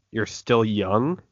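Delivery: ordinary talk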